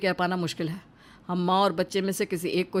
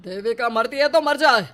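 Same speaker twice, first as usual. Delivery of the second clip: louder, cold and harsh; husband refusing to save Devika, raised voice; harsh refusal; 4 semitones higher, 5 dB louder than usual. Acted. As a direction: throw the words out without pity. Husband refuses to open the door.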